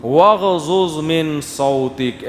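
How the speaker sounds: slow chanted Arabic recitation; Quranic recitation. Acted. Preacher reciting the verse to the congregation, slow chant. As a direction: recite the verse slowly and melodically.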